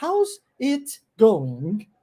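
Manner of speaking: slow "How's it going?" with every sound spelt out; over-careful "How's it going?", each word separate; mock prim teacher saying "How's it going?"; stiff textbook English. Shown as a demonstration. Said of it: "aquele inglês bonitinho que os professores".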